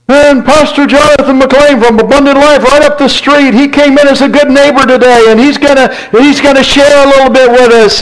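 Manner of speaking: host introduction voice. Retold or imitated.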